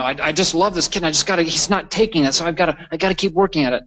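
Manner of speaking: lightly acted pleading insistence; earnest striving parent. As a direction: voice a parent who can't let it go. Voices an over-striving parent.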